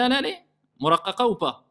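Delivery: normal talking voice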